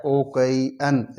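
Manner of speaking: ओ कई एन split into three slow separate syllables. Shown as a demonstration. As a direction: stretch ओ, कई and एन apart slowly. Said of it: "इसमें तीन syllable हैं". (ओ कई एन को धीरे-धीरे अलग-अलग सिलेबल में बोलना)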